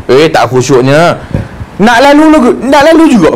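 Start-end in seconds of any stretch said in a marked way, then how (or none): 1.8-3.3 insistent passer-by. Retold or imitated. Preacher mimics a stubborn passer-by at prayer, pitched high and insistent.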